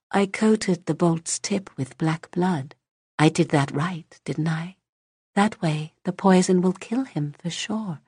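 put-on calmly menacing child; eerie child voice. Child character voice.

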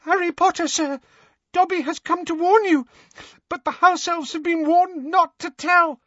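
terrified house elf squeaking his master's name. Acted. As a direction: squeak it like a frightened little elf. Dobby voice held.

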